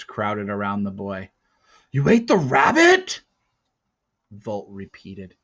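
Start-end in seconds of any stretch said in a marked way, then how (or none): 1.9-3.2 high and loud, strained with anger; taut voice. Acted. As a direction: snap the repeat tight and sharp. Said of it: "his voice taut".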